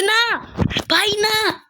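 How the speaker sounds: a yelp of despair; dismayed cry. Real, not acted. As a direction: wail at the sudden death. Despair at dying.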